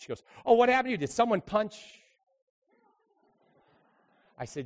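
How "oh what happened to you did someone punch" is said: loud, high mock-concerned piping up; sassy attitude. Hazel needling the pastor about his arm, loud sassy attitude. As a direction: pipe up with exaggerated attitude. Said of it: "pipes up with her attitude".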